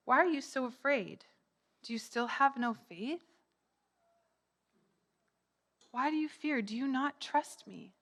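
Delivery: gentle questioning. Acted. Jesus to the frightened disciples, gentle questioning. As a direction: ask softly, with tender reproach.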